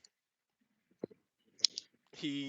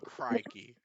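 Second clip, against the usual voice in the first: higher, hammed-up Australian crikey; mock Australian outburst; Australian exclamation; 13 semitones higher, 8 dB louder than usual. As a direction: toss off a cartoon Australian crikey. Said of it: "Australian accent".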